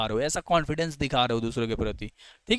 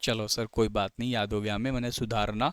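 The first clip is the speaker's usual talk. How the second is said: lower, softer student answering; voiced student reply; 6 semitones lower, 5 dB quieter than usual. Teacher answering as students who have memorised it, lightly voiced and low.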